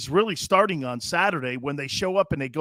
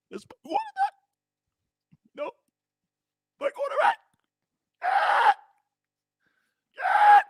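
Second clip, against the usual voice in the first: high wailing fake sob, much higher; mock crying. Host mocking T O crying over his quarterback, high fake wail. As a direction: wail it like a grown man blubbering.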